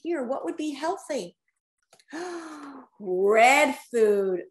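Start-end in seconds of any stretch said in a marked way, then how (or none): 2.1-2.7 soft gasp. Real, not acted. Reader gasps at picture.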